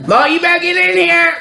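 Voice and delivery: high mock-stern calling. Calling the boy in.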